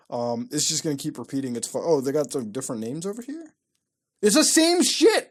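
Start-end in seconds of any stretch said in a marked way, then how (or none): 4.2-5.3 high, loud outburst; high-pitched cry of disbelief; exasperated yell. Real, not acted. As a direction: shout it in amused exasperation. Exasperated at repeated pictures.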